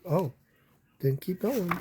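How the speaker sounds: usual speaking voice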